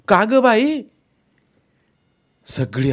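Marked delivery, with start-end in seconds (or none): 0.1-0.8 much higher startled cry; shocked question. Acted. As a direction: gasp it out in alarm. Shocked mother-in-law.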